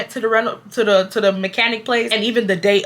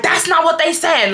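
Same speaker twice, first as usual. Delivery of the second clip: going off; 7 semitones higher than usual. Retold or imitated. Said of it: "I just went off".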